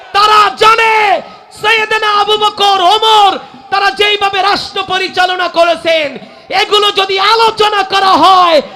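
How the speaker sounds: shouted, high-pitched sermon tirade; loud, high preacher's shout, dropping at the very end; full-force preaching. Real, not acted. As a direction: thunder accusations at a crowd, phrase by phrase.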